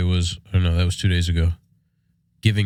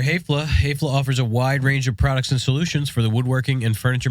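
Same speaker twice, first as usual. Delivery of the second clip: ad read; 5 semitones higher than usual. Acted. Host reads sponsor ad.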